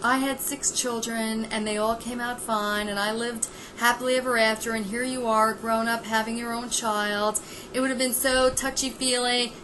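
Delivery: put-on hushed, tender old-school mother; soothing sixties mother. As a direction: coo it like a kind old-fashioned mom. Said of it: "if this was a mother in the nineteen sixties".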